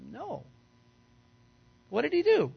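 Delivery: usual speaking voice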